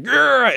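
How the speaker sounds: high, loud growl; Bobby Knight. Retold or imitated. Podcaster imitating a man in the crowd, high growl. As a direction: let out a high comic growl. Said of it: "Bobby Knight was like".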